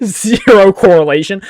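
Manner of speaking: laughing through the words; cracking up mid-sentence. Real, not acted. Laughing at the suspect's excuse.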